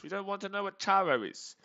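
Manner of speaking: usual speaking voice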